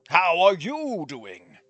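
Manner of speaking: loud, drawn-out fatherly check-in; concerned dad. Acted. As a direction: stretch the question out slowly, as a put-on dad. Acts Dad's pointed question on phone.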